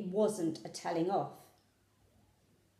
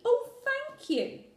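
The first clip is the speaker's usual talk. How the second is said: high, slow, heartfelt thanks; overjoyed; 3 dB louder, slower than usual. A narrator's reading. A girl thanking her mother for letting her go, overjoyed. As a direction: pour out gratitude.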